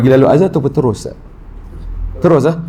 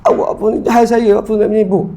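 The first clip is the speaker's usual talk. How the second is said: higher-pitched retorting wife; a pitched-up, indignant wife answering back; wife talking back; 8 semitones higher than usual. Retold or imitated. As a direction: push back at your husband, pitched up. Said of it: "isteri kata".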